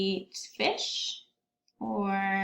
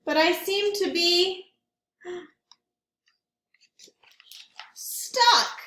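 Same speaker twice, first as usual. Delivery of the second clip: higher and louder, gasp before stuck; surprised stuck bear; 7 semitones higher, 4 dB louder than usual. Acted. Bear tumbles into his stuff and finds himself stuck, surprised. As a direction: catch your breath as you get stuck. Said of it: "tumbling headfirst into the stuff".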